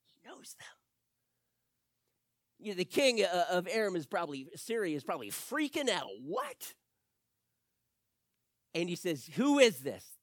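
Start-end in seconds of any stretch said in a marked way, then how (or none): 0.0-0.8 breathy secret whisper. Acted. Secret of the prophet. 6.2-6.7 high, shocked yelp; shocked outburst. Acted. King freaking out. 9.4-10.2 louder, indignant question; angry demand. Acted. King demands a name.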